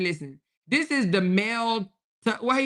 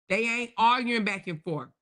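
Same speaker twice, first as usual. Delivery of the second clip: usual speaking voice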